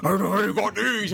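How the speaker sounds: loud, strained superhero growl; hammed-up, forced growling impression; mock the masked hero, loud and straining; Batman. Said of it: "and Batman's like".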